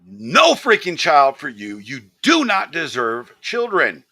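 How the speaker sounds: furious. Real, not acted. Streamer condemning a drunk mother, slow and furious. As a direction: hammer each word down in disgust.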